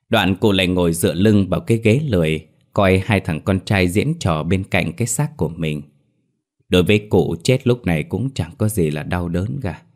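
ordinary delivery

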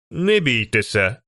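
usual speaking voice

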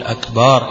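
أكبر slowly with the ba drawn out; stretched ba. Shown as a demonstration. Teacher shows the wrong stretched ba of أكبر. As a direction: drag the ba of أكبر. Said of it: "الباء بتاعت أكبر يمدها".

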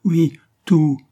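loud, high, dry retort; curt. A narrator's reading. Confessing woman contradicting William, curt. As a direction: throw it back sharply. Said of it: "répéta-t-elle sèchement".

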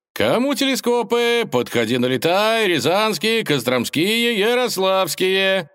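high, singsong market barker; high-pitched seller touting to a crowd; street hawker's cry. Acted. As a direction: call out your wares, stretching the last word. Market seller hawking his goods.

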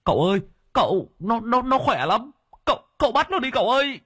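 high, fast pleading; workers crying out. A narrator's reading. Workers beg the master to catch the possessed man, high and fast. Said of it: "kêu lên".

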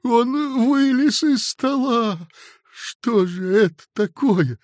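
bewildered clerk wailing in disbelief; a shaken, drawn-out lament; plaintive outcry. A narrator's reading. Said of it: "жалобно сказал Синему".

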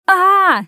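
sharp cry of sudden pain. A narrator's reading. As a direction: cry out as if stabbed. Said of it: "закричала она".

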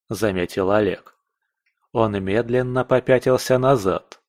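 ordinary delivery, nothing marked

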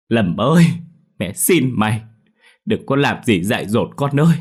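loud, high-pitched begging; crying plea. A narrator's reading. Mother begging her son to put the knife down, desperate plea. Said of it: "bà nữ gào khóc van xin".

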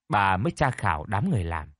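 ordinary delivery

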